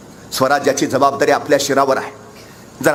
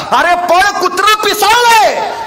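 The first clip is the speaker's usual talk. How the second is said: high, loud panicked yell; shouted warning; 14 semitones higher, 5 dB louder, slower than usual. Retold or imitated. Friend warns boy about a rabid dog, shouted warning. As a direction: yell a frantic alarm, higher and louder. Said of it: "त्याचा शेजारचा मित्र ओरडला".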